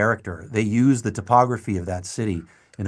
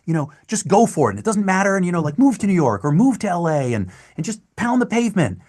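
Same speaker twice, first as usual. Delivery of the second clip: higher, eager and earnest pep talk; eager young advice; 7 semitones higher, 3 dB louder than usual. Retold or imitated. Young actor advising students at a talkback, eager and upbeat. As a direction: gush the idealistic advice. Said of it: "I'd be like".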